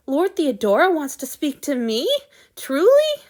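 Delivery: can't believe his luck, voice jumping up; amazed disbelief. A narrator's reading. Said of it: "Hanyu goggled at the god".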